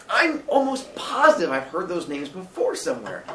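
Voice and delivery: put-on puzzled young Billy. Billy wonders to landlady where he heard names, puzzled.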